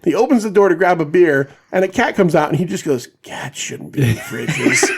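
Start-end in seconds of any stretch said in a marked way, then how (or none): 3.2-4.9 very slow, soft stoner voice; stoner kid. Retold or imitated. Stoned kid finding a cat in the fridge, slow stoner voice. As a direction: imitate a baked kid, words dragged out quietly. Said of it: "He's got this kind of voice".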